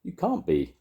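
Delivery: high granny contradiction; disbelieving Grandma. A narrator's reading. Grandma doubting Sam, high disbelieving voice.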